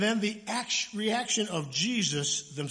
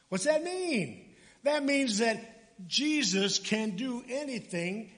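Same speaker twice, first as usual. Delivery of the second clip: normal talking voice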